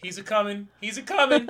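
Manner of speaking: mock doomsday preacher. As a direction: cry it like a prophet.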